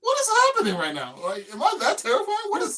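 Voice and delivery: high, loud, laughing outburst; cracking up in disbelief. Retold or imitated. Customer baffled at being feared, loud laughing outburst.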